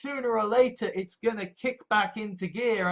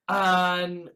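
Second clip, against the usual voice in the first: ordinary delivery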